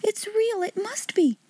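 put-on a little girl convincing herself; tearful girl. Sarah's voice, near tears.

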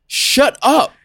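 high fed-up snap at the cat; annoyed snap. Retold or imitated. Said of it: "And you're just like".

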